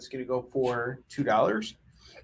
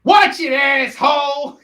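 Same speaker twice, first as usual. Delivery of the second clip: loud, high, drawn-out mock yell; hammed-up New York street heckle; imitated angry shout; 11 semitones higher, 11 dB louder, slower than usual. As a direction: holler it like an outraged passerby in a movie. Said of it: "she's like".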